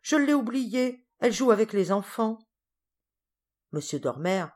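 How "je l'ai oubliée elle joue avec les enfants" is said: high-pitched dismayed admission; raised pitch, caught out forgetting; dismayed exclamation. A narrator's reading. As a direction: exclaim as the oversight dawns on you. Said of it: "s'écria mademoiselle Primrose".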